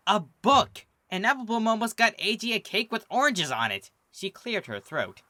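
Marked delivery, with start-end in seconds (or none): 0.1-3.9 higher, louder disbelief; incredulous. A narrator's reading. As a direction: exclaim in disbelief. Sweetie incredulous at friends' gifts.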